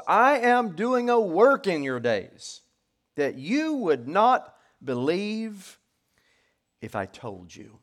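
loud, high, slow declamation easing off at the end; ringing pulpit reading; proclaimed scripture. Acted. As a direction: announce God's answer with weight. Reads God's answer aloud.

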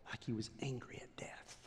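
whispered, intense; hushed. Acted. Preacher to congregation, whispered intensity. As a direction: breathe it out in a whisper. Dramatic hush.

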